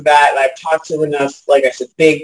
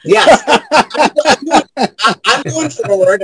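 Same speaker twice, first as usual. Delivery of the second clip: high burst of laughter on yes; laughing; 9 semitones higher, slower than usual. Real, not acted. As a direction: laugh it out, delighted. Laughing agreement.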